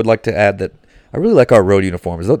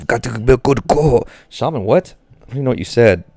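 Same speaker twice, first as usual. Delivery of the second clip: ordinary talk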